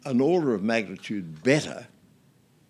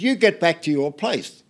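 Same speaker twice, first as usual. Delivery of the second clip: raised, higher command; firm order; 7 semitones higher, 6 dB louder than usual. Retold or imitated. Jacoby ordering Whitlam back to his seat, firm raised voice. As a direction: send him back firmly.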